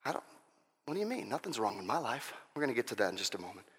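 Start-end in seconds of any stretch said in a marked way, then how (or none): 0.0-2.3 mock denial. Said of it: "to make us realize what's wrong".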